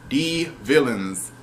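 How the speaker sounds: "The villains" drawn out and stressed; slow emphatic repeat. Real, not acted. Reviewer repeats what drags the episode down, slow and pointed. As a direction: lean on "The villains" with mock disdain. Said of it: "repeats for emphasis".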